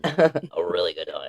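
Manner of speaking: very low, soft growl; echoing the low Beetlejuice growl. Pretend. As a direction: drop the voice way down. Echoes low voice.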